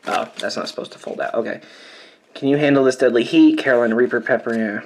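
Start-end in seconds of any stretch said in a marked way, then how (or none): 0.1-1.6 whispered aside. Real, not acted. Surprised aside to herself.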